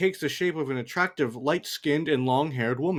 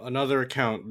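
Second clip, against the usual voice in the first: ordinary delivery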